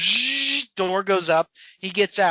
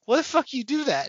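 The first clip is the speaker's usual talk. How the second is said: high mocking kid voice; high, teasing cartoon voice; comic character voice; 7 semitones higher than usual. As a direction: tease Pepe in a pitched-up voice. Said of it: "another character's like".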